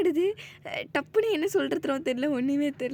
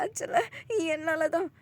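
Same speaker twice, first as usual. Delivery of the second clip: tearful; 4 semitones higher than usual. Acted. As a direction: voice breaking with guilt. Character crying in guilt.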